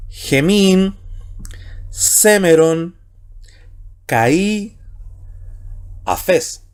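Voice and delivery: word by word, each drawn out with long pauses; Greek reading demonstration. Shown as a demonstration. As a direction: read the Greek out slowly and emphatically for students to follow. Reads Greek text word by word.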